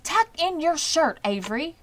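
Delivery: fussing aunt crying out; scolding cry. A narrator's reading. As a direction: call out a fussing reprimand. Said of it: "cried Miss Zuckerman".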